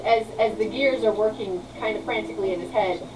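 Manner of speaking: normal talking voice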